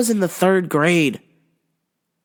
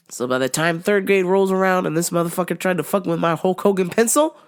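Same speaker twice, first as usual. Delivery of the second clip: ordinary delivery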